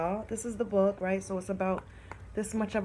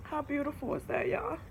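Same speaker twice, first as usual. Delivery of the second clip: teary; 4 semitones higher, 5 dB quieter, faster than usual. Real, not acted. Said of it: "I'm a little baby".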